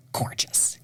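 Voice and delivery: hushed, reverent whisper. Real, not acted. Singer urging listeners toward Ravel, reverent whisper. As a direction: breathe the word out reverently.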